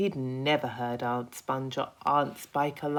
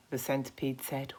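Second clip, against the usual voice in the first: ordinary delivery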